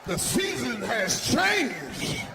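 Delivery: hammed-up astonished shout laughing at the end; shocked exclaiming. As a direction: blurt it out in amazed disbelief. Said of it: "I'm like".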